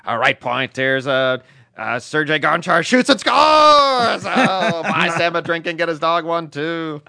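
loud, slow goal call that peaks in a big shout; hockey announcer. Retold or imitated. Imitating the Penguins' announcer calling a goal with a big shout. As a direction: bellow the goal like a TV play-by-play man. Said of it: "Mike Lang, the Penguins announcer".

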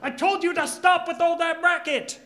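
loud high angry yell; angry shout. Acted. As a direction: bark it at him furiously. Thug yelling at partner.

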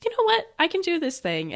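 put-on a boy suddenly believing in himself; mock inspired; inspired kid. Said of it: "he's like".

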